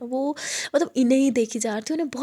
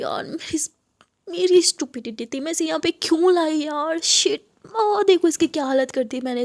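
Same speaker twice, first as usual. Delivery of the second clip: louder, higher frantic regret; upset self-blame; 5 semitones higher, 4 dB louder than usual. Acted. Aadiya to her DJ friend, frantic self-blame. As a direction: berate yourself in a panic.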